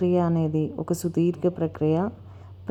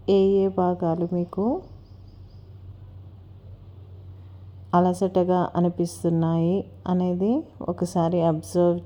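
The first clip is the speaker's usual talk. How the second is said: guided meditation. Acted. Instructor leading listeners to relax each body part, unhurried guided meditation. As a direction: guide them gently through breath and body, stretching key words.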